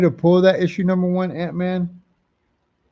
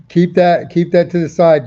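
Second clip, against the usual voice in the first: loud instruction; 2 semitones higher, 8 dB louder than usual. Real, not acted. Telling a viewer to hold item.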